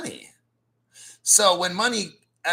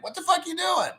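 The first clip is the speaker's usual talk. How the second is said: much higher, disbelieving yell; what are you even doing, mock outrage; incredulous outburst; 12 semitones higher, faster than usual. Reacting to newbies selling Bitcoin, incredulous high outburst. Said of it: "And I'm like".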